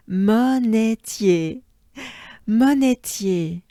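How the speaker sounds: monetier slow and loud, rising end; loud slow Monetier drill; drilled French word. Shown as a demonstration. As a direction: stretch monetier, lift the final E. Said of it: "we accentuate the E part".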